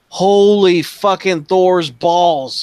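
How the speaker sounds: slow, loud, high astonishment; shocked exclamation. Acted. Man finds his garden frozen, slow loud shock. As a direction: draw the oath out in acted shock.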